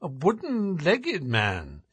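slow, mildly surprised echo; bland surprise. A narrator's reading. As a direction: feign casual surprise, drawn out. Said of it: "with bland surprise".

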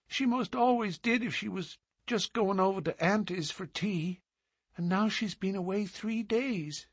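put-on high, soft child voice; a puzzled small girl, innocent; little girl. A narrator's reading. Little girl wonders where her mother went, high and innocent.

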